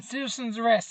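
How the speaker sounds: hammed-up citizen's arrest cry; mock shout. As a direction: yell it like a self-made hero. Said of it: "he jumps out going".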